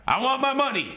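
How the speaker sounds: lightly hammed gangster echo; mock gangster demand. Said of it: "The way he said that".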